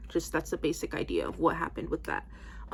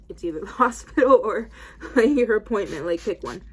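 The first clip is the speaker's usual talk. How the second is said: louder, half-laughing, insisting; exasperated ultimatum; 3 semitones higher, 9 dB louder than usual. Retold or imitated. To her stubborn grandmother, a loud, laughing ultimatum. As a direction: push her to choose, laughing in frustration.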